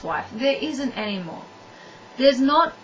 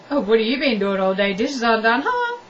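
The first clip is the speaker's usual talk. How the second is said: mock nag, a touch louder; nagging spouse; 4 dB louder than usual. Partner nagging about undone dishes, mock nag.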